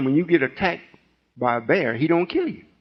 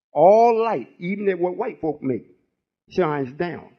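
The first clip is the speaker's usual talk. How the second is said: ordinary talk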